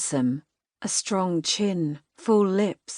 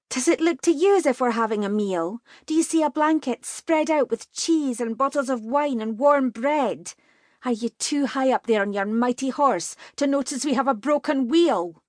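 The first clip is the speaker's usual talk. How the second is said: higher, biting sarcastic tirade; angry sarcasm; 7 semitones higher than usual. A narrator's reading. Katie mocks the rider from his mighty horse, high angry sarcasm. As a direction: pile on the scorn, higher and sharper. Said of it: "changed from fear to anger".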